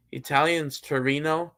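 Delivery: ordinary delivery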